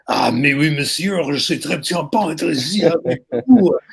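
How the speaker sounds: hammed-up French accent, pitch climbing; mock-French gush of a student putting it on; exaggerated French. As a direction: overdo the French to a ridiculous point. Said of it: "they exaggerated everything French to ridiculous point".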